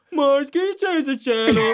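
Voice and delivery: imitating Homer. Retelling the funeral scene to friends, Homer impression. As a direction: whine it like a bored Homer. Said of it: "He's like".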